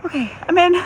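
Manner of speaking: high, tight breath from the icy water; cold shock gasp. Real, not acted. Stepping into the ice bath.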